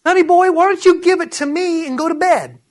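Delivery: high-pitched, put-on coaxing voice; mock coaxing Spirit. God coaxes the worrier.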